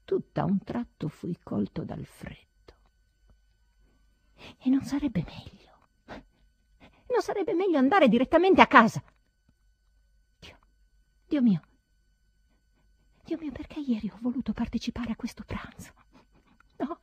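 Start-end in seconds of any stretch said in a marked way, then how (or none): 4.6-6.2 hushed doubt. Acted. 7.1-9.0 urgent outburst. Acted. Doubt bursting out. 10.4-17.0 despairing lament. Acted.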